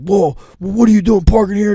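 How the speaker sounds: loud, high indignant demand; mock angry ranger. Retelling the ranger confronting his buddy, loud and high. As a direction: call him out like a jerk. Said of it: "the guy's like".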